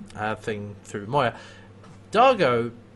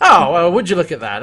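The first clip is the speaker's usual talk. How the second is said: sarcastic; 4 semitones higher, 10 dB louder than usual. Retold or imitated. Sarcastic exclaim at empty chamber.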